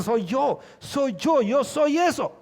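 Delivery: acted cry of recognition, rising in pitch; convicted worshipper. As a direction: confess it like a heart-struck worshipper. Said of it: "ese canto quebranta su corazón".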